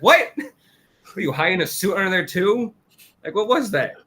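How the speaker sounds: high, startled squeak of disbelief; a girl freaking out at what she saw; shocked. Retold or imitated. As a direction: act stunned and squeaky. Kikuro freaking out, retold.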